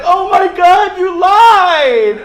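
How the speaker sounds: put-on high, loud wailing child; hammed-up sobbing nephew; crying kid. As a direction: wail it like a betrayed little kid. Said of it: "immediately started crying".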